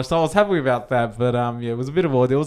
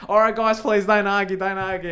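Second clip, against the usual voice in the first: high-pitched imitation of a peacemaking clerk; pleading intervention; 8 semitones higher, 3 dB louder than usual. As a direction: step in to calm two customers, voice pitched up. Quoting post office lady intervening.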